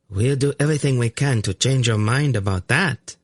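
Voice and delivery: high, sugary threat; sweet-voiced menace; chillingly sweet. A narrator's reading. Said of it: "in a chillingly sweet voice".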